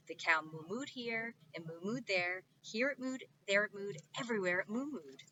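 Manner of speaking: ordinary delivery, nothing marked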